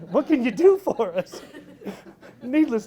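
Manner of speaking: laughing through it, high and loud. Real, not acted. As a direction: burst out laughing mid-question. Laughing at own question.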